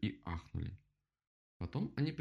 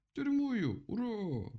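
high, louder rallying cry; cheering; 14 semitones higher, 4 dB louder, slower than usual. Acted. Animals back the prison plan, cheering. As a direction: cheer high and draw out the hurrah.

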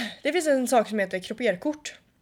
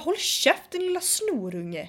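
scornful retold insult; angry; 5 semitones higher, slower than usual. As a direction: tell the brat off inside your head. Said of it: "Jag tänkte bara".

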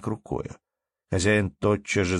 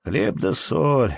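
slow, drawn-out greeting, pitched up; 6 semitones higher, much slower than usual. A narrator's reading. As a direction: stretch out the words of greeting. Антон greets in his turn.

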